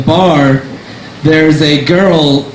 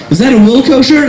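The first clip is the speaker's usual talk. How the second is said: higher, eager fan question; a stranger spotting a band shirt, eager and higher; excited question; 9 semitones higher than usual. Retold or imitated. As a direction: pounce on the shirt with glee. Guy at the bar, excited.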